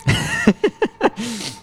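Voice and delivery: high burst of laughter. Real, not acted. Laughs at the innuendo.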